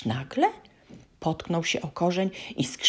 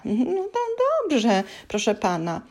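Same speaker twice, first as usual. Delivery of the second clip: tearful elf; 7 semitones higher than usual. A narrator's reading. Scared elf complies.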